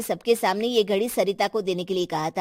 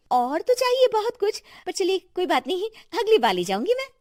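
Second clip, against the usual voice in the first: high greedy sigh; 8 semitones higher, faster than usual. Acted. Daughter-in-law wanting more.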